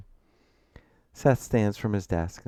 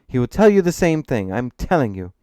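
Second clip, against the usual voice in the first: loud, insistent, irritated reply; annoyed; 5 semitones higher, 11 dB louder than usual. A narrator's reading. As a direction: start high and forceful, insisting. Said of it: "his tone betraying a sense of annoyance".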